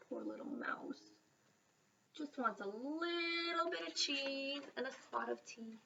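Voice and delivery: hushed, sympathetic aside; pitying whisper. A narrator's reading. Narrator pities the mouse to listeners, whispering. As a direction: sigh over the poor mouse softly.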